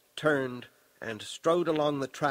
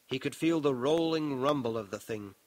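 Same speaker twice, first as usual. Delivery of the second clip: plain reading voice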